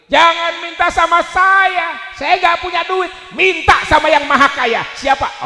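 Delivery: loud, high mock-angry rant; retold husband's telling-off, shouted; scolding his wife. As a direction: bellow it like an exasperated husband. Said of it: "saya omelin".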